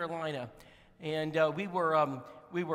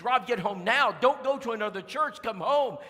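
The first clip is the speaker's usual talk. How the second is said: urgent command; 4 semitones higher, 7 dB louder than usual. Retold or imitated. Sister orders him home.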